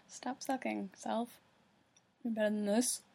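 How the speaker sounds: normal talking voice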